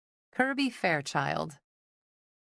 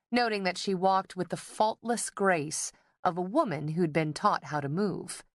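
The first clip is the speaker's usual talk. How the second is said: usual speaking voice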